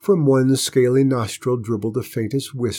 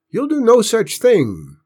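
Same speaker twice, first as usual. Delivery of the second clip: high, loud, firm refusal; firm raised voice; 12 semitones higher, 6 dB louder than usual. A narrator's reading. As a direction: block him with a loud no. Grandpa stops Uncle Ben.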